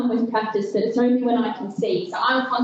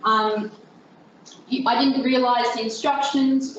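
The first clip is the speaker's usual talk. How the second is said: usual speaking voice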